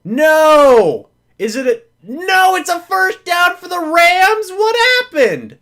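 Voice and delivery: high, loud disbelieving shout; shocked outcry. Real, not acted. Fan learning the Rams got the first down, shocked and loud. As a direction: cry out in disbelief.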